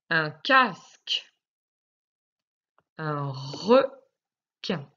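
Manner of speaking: words drawn out very slowly, k sound held clear; slow dictation. Shown as a demonstration. Teacher dictates the exercise words, very slow and careful. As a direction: say it slowly so the child finds the k. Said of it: "quand tu entends le son k".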